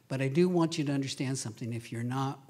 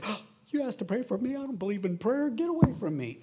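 higher, dismissive refusal; higher mock refusal of prayer; mock brush-off; 7 semitones higher than usual. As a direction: push them away like a scornful stranger. Imagined hostile refusal.

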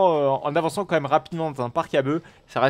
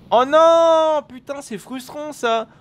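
frustrated cry; 12 semitones higher, 7 dB louder, much slower than usual. Real, not acted. Ride brakes on big drop.

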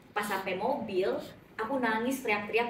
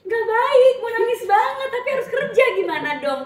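honest whiny answer; 11 semitones higher, 11 dB louder than usual. Retold or imitated. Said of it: "pengen gitu sekali-sekali".

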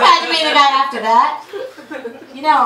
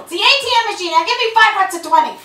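bossy trainer; 8 semitones higher, faster than usual. Acted. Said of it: "I go".

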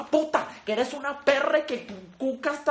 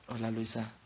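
much lower, much softer crooning voice; 11 semitones lower, 10 dB quieter than usual. Acted. Voicing the man in the video to Luisa, low seductive murmur. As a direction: croon it low and soft like a lover.

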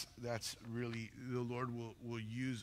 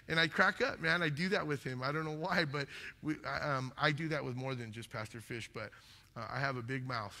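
normal talking voice

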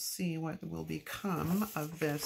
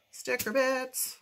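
higher, louder, slow playful sticker bits; a playful lilting repeat; playful singsong; 8 semitones higher, 7 dB louder, slower than usual. Shown as a demonstration. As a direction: sing it out like a silly little chant.

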